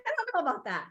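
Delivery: fast, high laughing repeat. Retold or imitated. Laughing at her own retold reaction, high and fast. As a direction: giggle through the words.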